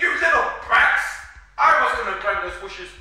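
loud, high outraged yell; angry scolding. Acted. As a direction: burst out at them, then ease slightly. Villains scold the wish stealers.

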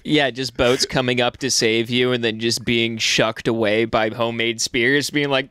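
ordinary talk, nothing marked

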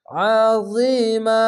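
slow drawn-out tajweed chanting; Quran recitation. Shown as a demonstration. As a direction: recite it slowly, stretching the vowels. Recited word.

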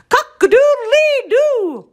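loud, very high, drawn-out crow; rooster crow. A narrator's reading. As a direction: crow out a wailing alarm. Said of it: "they heard a loud yell".

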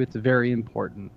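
ordinary talk

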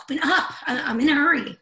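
loud, high, frantic demand; urgent shout. Acted. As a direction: yell at the door to open. Door won't open.

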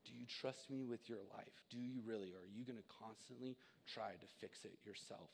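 God offering help, hushed and tender; gentle voice of God. Pretend. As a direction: speak as God, soft and reassuring. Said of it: "And Jesus is going".

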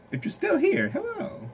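high, teasing sing-song; playful high voice. Pretend. Tarot reader teasing viewers who stayed, high sing-song. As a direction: tease the listeners who stayed.

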